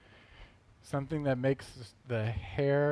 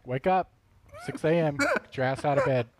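loud wake-up call; 3 semitones higher, 7 dB louder than usual. Retold or imitated. Voicing a morning wake-up call.

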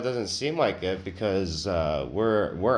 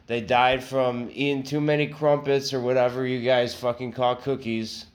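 ordinary talk, nothing marked

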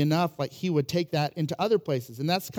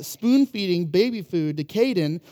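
usual speaking voice

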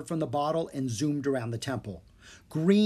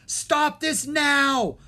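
very slow, high, loud furious order; villain losing control, raging; fuming; 12 semitones higher, 5 dB louder, much slower than usual. A narrator's reading. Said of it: "Maldred fumed".

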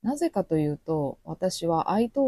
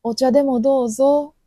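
loud, higher mock polite offer; Kyoto offer; 7 semitones higher, 8 dB louder than usual. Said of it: "京都で". (大きく高めの作り丁寧な声)

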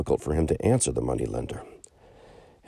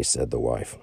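ordinary delivery

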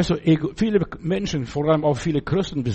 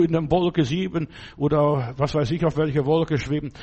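ordinary delivery

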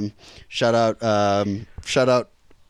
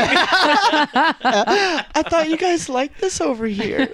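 laughing; 8 semitones higher, much slower than usual. Real, not acted. Laughing slow high line.

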